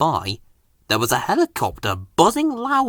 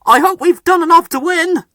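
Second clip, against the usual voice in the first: anxious hope; 14 semitones higher, 6 dB louder than usual. A narrator's reading. Driver anxious.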